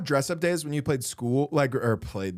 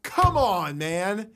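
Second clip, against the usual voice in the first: high exasperated oh come on; drawn out in disbelief; 6 semitones higher, slower than usual. Real, not acted. Reacts to preacher's claim.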